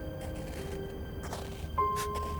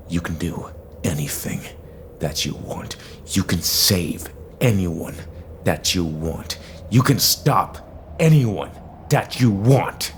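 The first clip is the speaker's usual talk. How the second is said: building angry rebuke. Acted. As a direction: hammer each phrase harder than the last. Angry rebuke building over repeated phrases.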